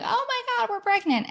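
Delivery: high thrilled squeal of joy; ecstatic announcement. Retold or imitated. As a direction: burst out the news, overjoyed. Announcing pregnancy to husband.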